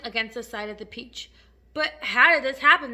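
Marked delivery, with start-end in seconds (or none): 1.7-2.9 loud, distressed question; bewildered cry. A narrator's reading. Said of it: "they cried".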